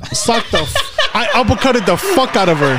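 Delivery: laughing through the story's punchline; laughing while telling. Real, not acted. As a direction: tell it while cracking up.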